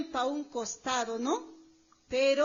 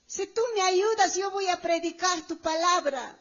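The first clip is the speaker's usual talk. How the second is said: raised, higher imploring promise; pleading vow; 4 semitones higher, 5 dB louder than usual. Retold or imitated. Believer promising God to preach, pleading loudly. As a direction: bargain aloud with God. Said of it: "hemos dicho".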